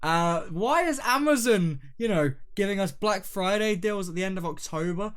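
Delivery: high, slow, loud trailer voice; hype announcer. Acted. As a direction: sell the episode like a promo. Podcast trailer intro.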